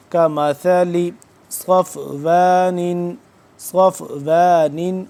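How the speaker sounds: slow, measured tajweed chant; Quran recitation. Acted. Teacher recites the verse aloud for students: slow tajweed recitation. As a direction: recite each word drawn out and melodic.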